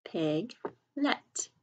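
"Piglet" split as Pig, pause, let; slow, broken-up "Piglet" for early readers; syllable by syllable. Shown as a demonstration. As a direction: sound out "Piglet" in two separate syllables. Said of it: "sound it out by syllables".

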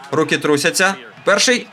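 normal talking voice

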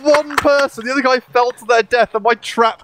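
high, insistent correction; indignant argument. Real, not acted. Teammate disputing who carried, high and indignant. Arguing over who won the round.

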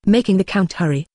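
usual speaking voice

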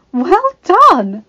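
loud, high cheer of praise; mother applauding a recital; delighted exclamation. A narrator's reading. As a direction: exclaim it proudly, voice leaping up. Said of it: "Soleil exclaimed".

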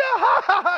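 high, loud cackling ha-ha; mocking laugh. Officer laughing at the pranked traveler, loud high laugh. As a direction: laugh at the victim you just fooled.

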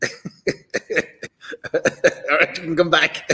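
a laugh breaking into the words; laughing through it. Real, not acted. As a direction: let the laugh spill into the line. Host laughing, calls guest back.

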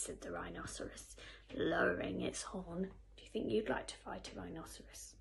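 usual speaking voice

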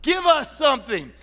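loud, high, urgent plea; acted shout for mercy; lepers crying out. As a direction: beg for help at full voice. Acting lepers crying out for help.

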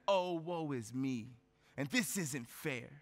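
slow, low, sulky woe-is-me voice; mock whining. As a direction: drag it out like a sulking complainer. Mock self-pity quote.

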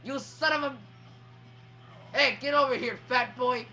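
loud, worked-up trash talk; shouted taunting. Real, not acted. Player yelling at Bowser in a fight, taunting shout. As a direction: call the opponent out at full voice.

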